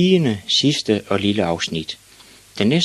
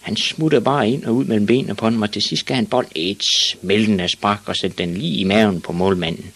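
plain reading voice

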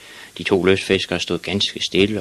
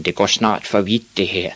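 usual speaking voice

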